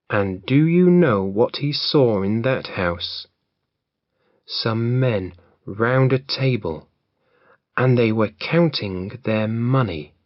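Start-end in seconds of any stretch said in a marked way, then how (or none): none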